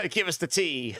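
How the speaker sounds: amused, laughing mock request. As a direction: giggle through the retold request. Laughing at own retold reply.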